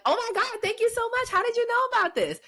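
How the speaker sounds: squealing, high, fast gushing thank-you; high-pitched mock delight at a surprise. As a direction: gush like someone thrilled by a gift. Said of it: "You want them to be like".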